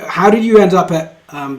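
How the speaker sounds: ordinary talk, nothing marked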